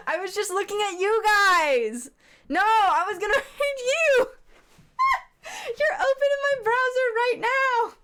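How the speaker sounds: high, loud squeal breaking into laughter; flustered, laughing squeal. Real, not acted. Streamer caught out by the friends being raided, flustered squeal. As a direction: squeal it through giggles, really flustered.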